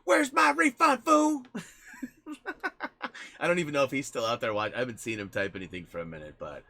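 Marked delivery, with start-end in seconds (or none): 0.0-1.4 high, very loud mock demand; hammed-up shouted complaint; Joey. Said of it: "He'll be like". 1.5-3.3 real high, wheezy giggle; tickled laughter. Real, not acted. Laughing at own impression.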